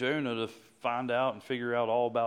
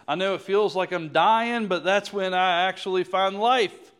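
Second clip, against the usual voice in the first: raised, building preacher's cry; fervent prayer, louder at each line; impassioned plea to God; 6 semitones higher, 8 dB louder than usual. Acted. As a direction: cry it out to God with rising force.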